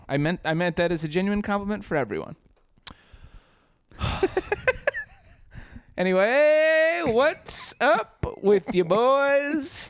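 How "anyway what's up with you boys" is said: slow drawl. Acted. Guest's drawled greeting after a laugh.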